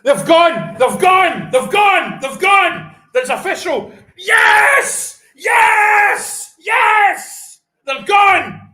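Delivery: high, loud shouts of joy; triumphant, full-voiced roar on repeat; ecstatic shouting. Real, not acted. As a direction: yell the breaking news in celebration. Live celebration of breaking sports news.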